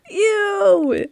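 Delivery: high, loud, drawn-out disgust; grossed-out ew. Real, not acted. Player reading about ice cream on abs, high disgusted squeal. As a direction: squeal ew in revulsion.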